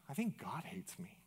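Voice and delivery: quiet, whispered retelling of a hurt confession; hushed confession. Retold or imitated. Man confessing to a pastor, hushed. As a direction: let it drop to a near whisper.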